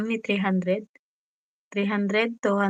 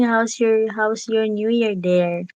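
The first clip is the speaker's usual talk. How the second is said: normal talking voice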